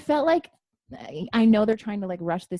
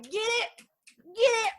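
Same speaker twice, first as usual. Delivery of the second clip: high, loud cheering at the screen; a fan yelling high and loud at a big moment; excited cheering; 11 semitones higher, 4 dB louder, slower than usual. Retold or imitated. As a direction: cheer the TV on, thrilled. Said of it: "and I was like".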